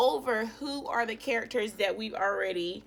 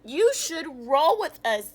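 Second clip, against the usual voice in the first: higher, louder invitation; cheerful zebras; 6 semitones higher, 8 dB louder than usual. Acted. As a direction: call it out like happy zebras.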